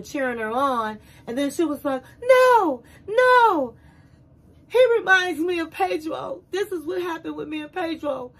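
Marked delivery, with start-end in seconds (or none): 2.2-8.3 imitating a tearful outburst; upset crying out. Host's guest protesting on stage through tears, mock distraught. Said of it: "it showed her breaking down, crying".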